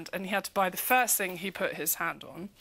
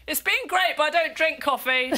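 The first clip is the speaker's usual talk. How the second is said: raised, high call back; mock shout; 8 semitones higher, 5 dB louder than usual. Man calling back across the street, loud mock shout. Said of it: "he shouted back and went".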